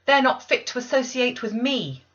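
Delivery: put-on high, loud defiant cry of a child; sudden outcry. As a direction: hurl it down the stairs. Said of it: "I cried out suddenly".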